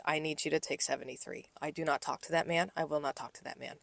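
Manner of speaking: low, hushed, firm request; serious. Retold or imitated. Server asking a coworker to take her table, quietly serious. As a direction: keep it down and mean it. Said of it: "he could see that I was serious".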